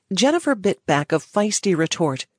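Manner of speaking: ordinary delivery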